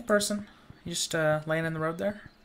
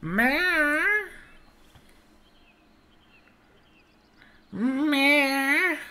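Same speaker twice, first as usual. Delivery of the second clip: long high mewing meow; cat meow; 6 dB louder, much slower than usual. Pretend. Playing the cat, a long high meow.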